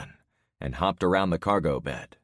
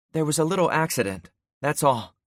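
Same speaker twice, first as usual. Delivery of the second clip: reassuring mother; 9 semitones higher, 4 dB louder than usual. Acted. Mother downplays crash to child.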